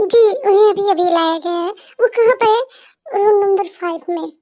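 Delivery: ordinary delivery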